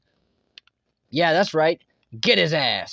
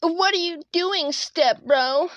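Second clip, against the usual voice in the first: put-on much higher, breathy-light girly voice; girl voice impression; 7 semitones higher, slower than usual. Teasing his teammate, doing a higher girl voice. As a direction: play a helpless girl asking for help. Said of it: "do a girl voice impression".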